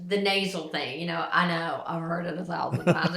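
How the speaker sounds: normal talking voice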